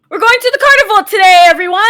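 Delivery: high, loud and fast proclamation; loud announcement. Acted. Salem announcing the outing to everyone, loud and quick. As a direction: call it out to the whole house.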